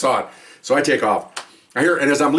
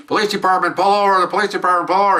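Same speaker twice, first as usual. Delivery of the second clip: PA announcement; 5 semitones higher than usual. Retold or imitated. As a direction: bark it like a police loudspeaker. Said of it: "he's on the P A system going".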